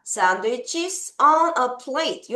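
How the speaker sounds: very slow "sandwiches on a plate", each word drawn out; slow teacher demo; slow model reading. Shown as a demonstration. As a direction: model it slowly for the class to repeat. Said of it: "이렇게 표현을 해주고요".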